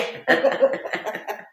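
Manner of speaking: breathy airy laugh, real amusement. Real, not acted. Patient laughing at her own hospital story, airy chuckle. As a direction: let out a light unvoiced chuckle. Laughing after the grim quote.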